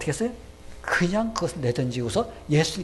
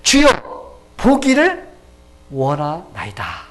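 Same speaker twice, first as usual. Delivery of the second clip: fervent plea; 7 dB louder, much slower than usual. Acted. Blind beggar answering Jesus, loud fervent plea easing down. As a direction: cry out, then let it settle slowly.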